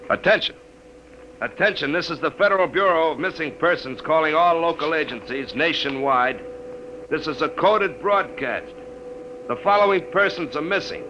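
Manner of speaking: slow, measured on-air broadcast read; radio announcement. Acted. Charlie reads missing persons list over the air, announcer read.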